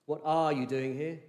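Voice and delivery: ARE hit hard, rising at the end; stress on ARE. Shown as a demonstration. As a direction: lean on the word are. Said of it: "where the emphasis you could put".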